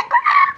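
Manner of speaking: very high comic squeal of a bad note; kicked-cat yelp. Interviewer imitating vocals gone off key, kicked-cat yelp. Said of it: "Kama ka, kapusi kamepigwa teke".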